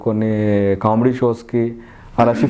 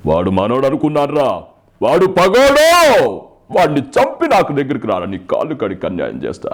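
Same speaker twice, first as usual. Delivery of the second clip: put-on film villain voice, erupting into a long roar; Sampath; 3 dB louder than usual. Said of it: "Sampath గారిది". (Villain గర్జనతో mimicry)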